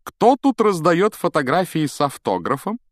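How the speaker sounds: high, loud, showy voice; grand theatrical question. A narrator's reading. Vain teacher asks who is signing photos, theatrical. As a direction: ask it like a delighted star.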